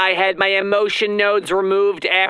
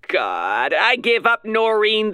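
usual speaking voice

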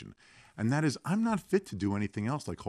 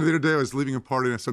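ordinary talk, nothing marked

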